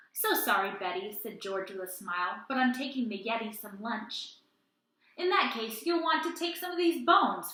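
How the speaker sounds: put-on lively read-aloud to children; animated children's story reading; storytime narration. A narrator's reading. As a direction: tell it like a picture book at storytime. Reads picture book aloud.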